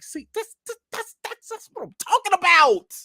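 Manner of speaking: high, stuttering build to a loud exclamation; thrilled approval. Retold or imitated. Retold thrilled reaction to Keaton's kindness.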